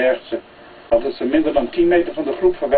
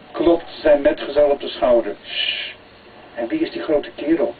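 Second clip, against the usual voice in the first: ordinary delivery, nothing marked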